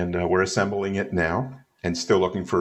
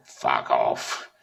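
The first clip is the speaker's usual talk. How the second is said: much lower put-on grump voice; curmudgeonly rebuff; 9 semitones lower than usual. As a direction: drop low and play the old curmudgeon dismissing a fan. Retells [name]'s gruff rebuff.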